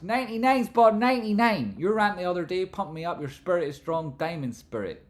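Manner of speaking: high, loud put-on hype voice; hyped-up voice. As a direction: read it like a pumped-up announcer. Re-reads superchat in hype voice.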